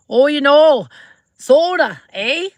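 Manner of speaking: high, loud mock Canadian; loud, high playful Canadian impression; Canadian accent. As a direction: ham up a Canadian saying eh. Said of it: "in Canada, it's like".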